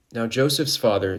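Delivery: plain reading voice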